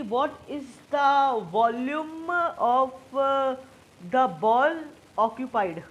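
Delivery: very slow, loud, drawn-out words with pauses; deliberately slow question. Real, not acted. Teacher posing a tricky quiz question to a student, deliberately slow. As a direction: spell out the question word by word, stretched and emphatic.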